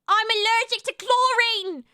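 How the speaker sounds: high, loud mock British; frustrated, high-pitched British imitation; British-sounding accent attempt. Shown as a demonstration. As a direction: exaggerate the copied British-like accent. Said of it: "But it's still British".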